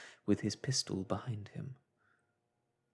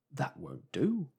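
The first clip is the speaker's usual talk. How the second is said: higher, slow shocked objection; a hint of an alarmed policeman; 5 semitones higher, 3 dB louder than usual.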